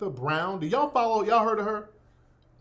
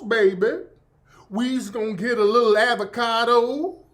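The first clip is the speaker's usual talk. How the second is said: exaggerated Southern accent; 3 semitones higher, 4 dB louder than usual. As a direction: lay the Southern accent on thick, lilting up at phrase ends. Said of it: "don't really talk like that".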